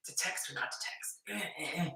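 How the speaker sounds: whispered to herself, torn; whispered dilemma. Retold or imitated. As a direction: whisper the dilemma under your breath. Woman torn over texting him.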